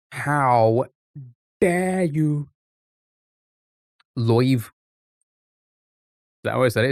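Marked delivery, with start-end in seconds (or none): 0.1-2.5 drawn out, with a mid-phrase pause; playfully affronted, word by word; mock offence. Streamer repeats the protest to chat, mock offence. 4.1-4.7 Brummie vowel, live as "loyv"; Brummie accent. Shown as a demonstration. Streamer tests how live sounded to chat, Brummie accent. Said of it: "you sounded brummy".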